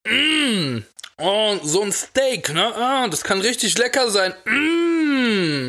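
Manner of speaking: drooling over food. Pretend. Savoring steak talk.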